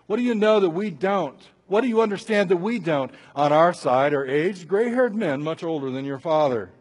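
loud, indignant comeback; prideful challenge. Acted. Friends clashing with Job in pride, loud challenge. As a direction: press each question home forcefully. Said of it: "we see a clash of ego here".

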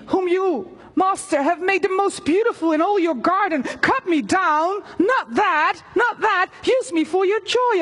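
anguished high-pitched pleading; pleading bamboo. Acted. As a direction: beg the master not to cut you down.

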